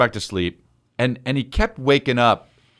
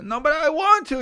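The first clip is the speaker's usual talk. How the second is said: high, whiny little-boy imitation; protesting child. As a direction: mimic a small son pleading with the nanny. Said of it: "he was like".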